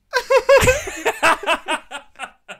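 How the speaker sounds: high, loud burst of laughing; loud laughter. Real, not acted. Host laughs at the attractiveness question, loudly. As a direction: crack up way up high.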